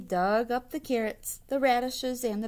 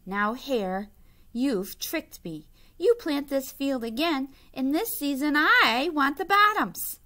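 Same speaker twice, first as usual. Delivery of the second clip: grumbling Bear; 5 semitones higher, 7 dB louder than usual. A narrator's reading. Bear complaining he was tricked.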